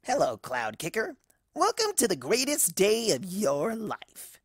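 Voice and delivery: slow, purring welcome; seductive. Acted. Kiki wraps a wing round Cloud Kicker, slow and seductive. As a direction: draw her in, savouring each word.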